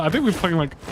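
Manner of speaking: normal talking voice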